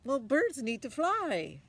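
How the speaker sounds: questioning mother. Acted. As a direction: press him like a doubtful mom.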